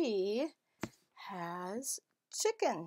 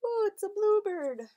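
much higher, delighted ooh; excited reveal; 10 semitones higher, 7 dB louder than usual. Acted. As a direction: coo with delight at the find. Reveal behind yellow door.

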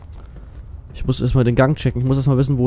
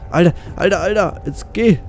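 tense pleading; 7 semitones higher than usual. Real, not acted. Monster approaching.

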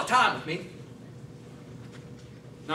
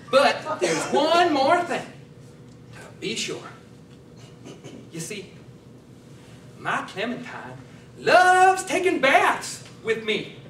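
Cletus confiding; 5 semitones higher, 4 dB louder than usual. Acted. Cletus shares one more thing, slow yokel voice. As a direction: lean in, then burst out high. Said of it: "I'm Cletus".

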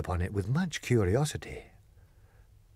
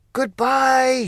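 crowd shout; 13 semitones higher, 6 dB louder than usual. Acted. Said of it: "shouted everyone".

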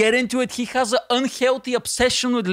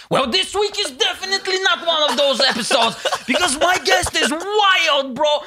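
hyped-up intro; 6 semitones higher than usual. Acted.

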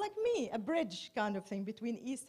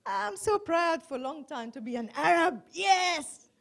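loud, high jubilant cry; excited, proud; 6 semitones higher, 10 dB louder than usual. Real, not acted. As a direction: build from emphatic pride to an exultant shout. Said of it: "I'm really excited about".

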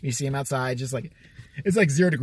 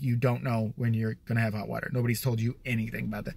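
condescending; 5 semitones lower, 5 dB quieter than usual. Retold or imitated. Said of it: "I'm a condescending bastard at that point".

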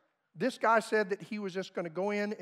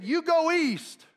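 higher, louder, slow command; defiant rebuke; 8 semitones higher, 5 dB louder, slower than usual. Retold or imitated. Woman rebuking Satan's voice in her head, a bold higher louder command. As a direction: order the devil off firmly. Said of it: "she said".

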